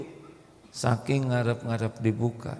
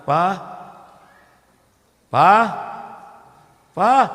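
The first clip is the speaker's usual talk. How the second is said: louder and louder calls to the husband; wife calling out; 7 semitones higher, 8 dB louder, slower than usual. Acted. Wife calling her Quran-reading husband, louder each time. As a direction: call him three times, rising each time.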